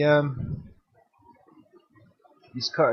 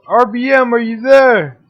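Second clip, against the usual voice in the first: louder and higher each time, building to a shout; calling out; 9 semitones higher, 13 dB louder than usual. Real, not acted. S1 shouting for RBM after the call cuts out, building louder. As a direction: keep calling a caller who has dropped out.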